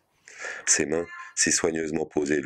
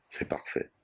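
quiet; 7 dB quieter than usual. A narrator's reading. Son reassures worried mother.

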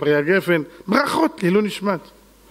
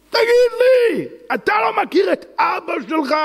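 preacher's rebuke; 12 semitones higher than usual. Acted. Rebuking the congregation.

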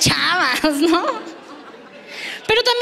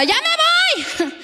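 high, drawn-out call from the doorway; retold shout from the door; 13 semitones higher, much slower than usual. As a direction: roommate calling out as she leaves. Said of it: "me gritó desde la puerta". (grito alto y alargado desde la puerta)

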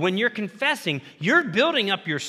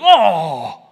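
loud, sudden startled "Oh!"; startled cry; 10 dB louder than usual. Retold or imitated. Preacher acts out a man whose hammer slips, with a loud cry. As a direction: burst out in shock as the nail flies. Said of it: "and he's like".

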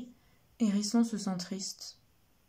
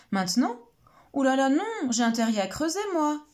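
higher, louder hurried cry; rabbit exclaims; 5 semitones higher, 6 dB louder than usual. Acted. Rabbit cries out to dodge Turtle's kiss request. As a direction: blurt out an excuse in a rush. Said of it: "s'écrie Lapin".

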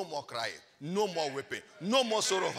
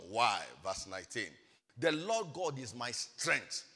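normal talking voice

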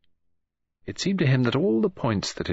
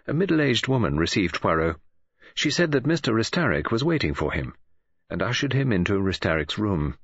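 usual speaking voice

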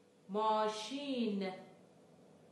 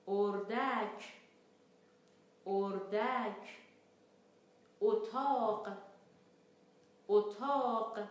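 each word read slowly and clearly, twice; slow teaching read; slower than usual. Shown as a demonstration. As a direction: read each word carefully for learners to repeat. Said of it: "کلماتی رو که صدای او دارند رو با هم دیگه بخونیم". (خواندن آرام و شمرده برای تکرار)

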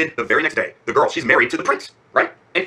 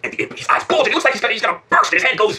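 high-pitched, building to a near shout; climactic storytelling; 11 semitones higher, 3 dB louder than usual. A narrator's reading. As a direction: narrate an explosion about to happen, higher and higher. Imp's rage at climax of story.

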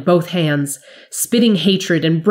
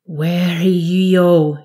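slow, drawn-out, desperate wail; strained frantic plea; desperate scream; 2 semitones higher, 3 dB louder, much slower than usual. A narrator's reading. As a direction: cry it out in panic, stretching the words. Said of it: "he screamed desperately".

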